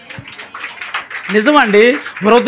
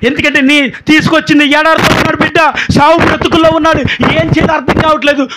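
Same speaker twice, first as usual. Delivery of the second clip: higher, louder, rising preacher's appeal; fervent preaching; 7 semitones higher, 6 dB louder than usual. Acted. Preacher appeals to the congregation with mounting fervour. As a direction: hammer each question home to the crowd.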